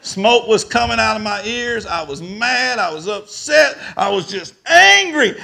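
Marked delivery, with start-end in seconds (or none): 4.0-5.4 loud, high burst on angry; worked-up anger. Retold or imitated. Preacher reliving being called a liar, loudly worked up. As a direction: blurt it out, voice jumping up. Relives his rising fury.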